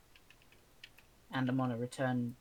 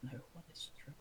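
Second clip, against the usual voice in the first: unvoiced conspiratorial whisper; 2 semitones higher, 11 dB quieter than usual. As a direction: whisper it as a joke aside. Joke aside to friends.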